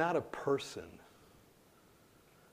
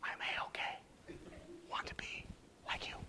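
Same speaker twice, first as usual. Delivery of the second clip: low-volume, whispery quoted answer; hushed reply; 12 dB quieter than usual. Retold or imitated. Telling the patient he wants to be like him, hushed whisper. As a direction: whisper it, barely voiced.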